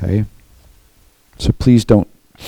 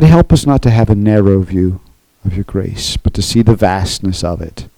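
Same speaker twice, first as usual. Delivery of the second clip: normal talking voice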